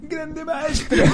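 slow, high, soft admiring praise; hushed awe for a hero. Pretend. Guest privately praises the scammer, slow and hushed with admiration. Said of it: "Y en la intimidad".

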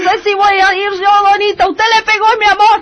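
high, loud, fast outraged shopkeeper voice; high indignant Teresa. Acted. Teresa protests to the wife who hit her lover, loud and high.

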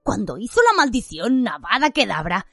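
chico impresionado, voz animada que sube y baja; entusiasmo juvenil. Acted. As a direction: comenta la clase con admiración y viveza. Ron comenta entusiasmado la clase. (entusiasmo de alumno)

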